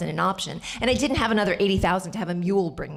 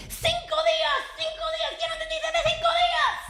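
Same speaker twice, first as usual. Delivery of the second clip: much higher, rushed, half-laughing desperate protest; shrill frantic protest; faster than usual. Retold or imitated. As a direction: squeak it out in exasperation. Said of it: "so I tell him".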